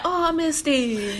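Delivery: slow, high sheepish excuse; mock fake apology. Imitating Americans excusing a missed show, slow and sheepish. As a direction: drag it out like a lame excuse. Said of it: "And they be like".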